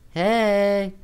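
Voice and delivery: high, loud cheerful hey; bright greeting. Acted. Amy calls to John through camera, bright. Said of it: "she said to the camera brightly".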